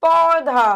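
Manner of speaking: पौधा loud, au held open; wide open mouth. Shown as a demonstration. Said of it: "बड़ा मुंह भी खुल जाता है". (पौधा में औ को मुंह खोलकर बोलें)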